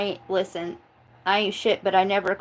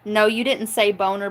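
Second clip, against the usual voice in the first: ordinary delivery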